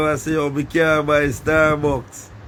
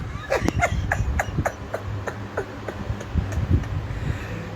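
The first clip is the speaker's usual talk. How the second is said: high airless laugh; breathless laugh; 8 dB quieter than usual. Real, not acted. Vlogger wheezing with laughter after quoting people, high airless laugh.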